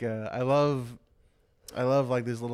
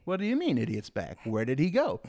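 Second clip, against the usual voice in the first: mock baffled hero asking; mock confusion; 2 semitones higher than usual. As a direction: sound lost and confused.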